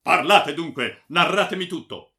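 loud, high, impatient order; shouted command. A narrator's reading. Baron pressing the silent friar to tell all, shouting. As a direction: order him to talk at the top of your voice. Baron demands the whole tale.

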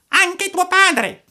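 very high, slow, aghast question; horrified puppet. Acted. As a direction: recoil in horror. Pinocchio horrified.

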